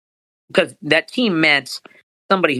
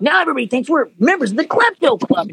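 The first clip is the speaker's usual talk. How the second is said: much higher, put-on character voice; 11 semitones higher than usual. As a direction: recite it as a cartoonish character line, pitched way up. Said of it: "start reciting lines about the Klepto".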